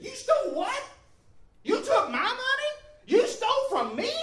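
high, indignant questioning; imitating a stern woman's outraged disbelief. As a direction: confront him, pitch leaping up on each question.

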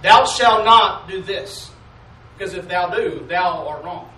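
slow, loud, booming commandment voice; drawn-out mock commandment, loud. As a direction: thunder out the law like a stern judge. Preacher mocking legalistic commandments.

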